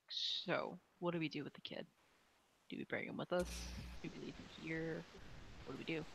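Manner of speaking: hushed whisper. Acted. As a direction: whisper it so others can't hear. Private huddle off to side.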